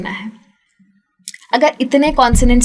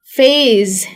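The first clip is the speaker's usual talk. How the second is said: ordinary delivery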